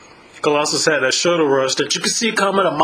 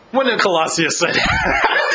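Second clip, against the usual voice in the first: imitating friend; 7 semitones higher than usual. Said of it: "See, now I'm talking like".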